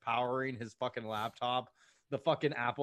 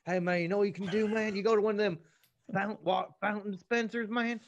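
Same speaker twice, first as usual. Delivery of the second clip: mocking Dalton voice; 5 semitones higher, 4 dB louder than usual. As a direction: ham up a dopey good-old-boy buddy. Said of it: "He's like".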